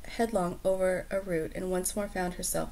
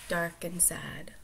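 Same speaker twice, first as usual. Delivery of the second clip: whispered; 9 dB quieter, slower than usual. Real, not acted. Softly trailing off.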